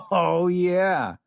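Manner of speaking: laughing through the words. Acted. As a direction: chuckle as you answer. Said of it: "Michael laughed".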